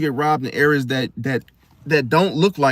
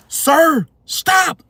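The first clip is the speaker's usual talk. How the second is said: high, loud command to halt; acted stern shout; 11 semitones higher, 5 dB louder than usual. Security stops a customer causing a distraction, high sharp shout. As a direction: call out sharply to stop someone. Acted guard confronting a shoplifter.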